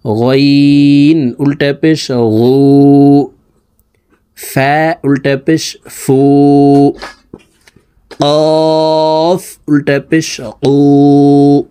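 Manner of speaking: slow sing-song spelling chant; patient lesson chant, lines drawn out; drill recitation. Shown as a demonstration. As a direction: recite each letter for the class to echo. Teacher drills letter recitation.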